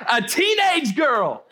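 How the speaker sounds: proclaimed slowly at full volume; shouted punchline. Real, not acted. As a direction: hit it hard and drawn out for the room. Punchline of the reveal, repeated.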